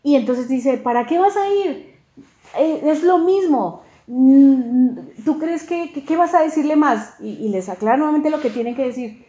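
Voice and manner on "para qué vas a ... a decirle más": higher, louder questioning retort; mock dissuasion. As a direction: act out talking someone out of it, pitched up. Réplica actuada para disuadir a Ahimás. (réplica disuasiva, aguda y fuerte)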